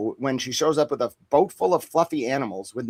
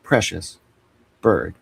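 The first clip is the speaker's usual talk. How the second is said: mock solemn; 5 semitones lower, 5 dB louder than usual. Mock-solemn farewell to the listeners. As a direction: hand over the bird like a sacred duty.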